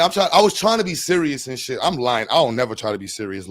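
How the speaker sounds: usual speaking voice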